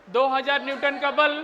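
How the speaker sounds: teacher dictating word by word. Acted. Teacher dictates a physics question.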